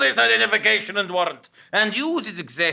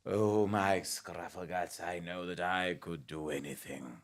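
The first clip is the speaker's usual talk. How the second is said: tender confession; 13 semitones lower, 13 dB quieter than usual. Acted. Lovestruck officer confessing to his clerk, low and tender. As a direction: breathe it to your beloved.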